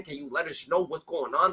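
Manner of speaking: ordinary talk, nothing marked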